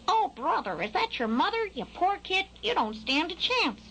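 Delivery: high, pitying crow voice; groaning crow. A narrator's reading. Crow pitying Skylark over his mother, high groan. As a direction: commiserate in a squawky groan. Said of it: "The crow groaned".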